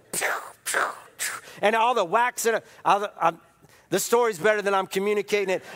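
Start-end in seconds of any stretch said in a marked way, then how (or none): 0.1-1.3 whispered pew pew; breathy little block noises; blocking sound effects. Retold or imitated. Daniel-san blocking strikes with whispered pew noises. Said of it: "And Daniel-san goes".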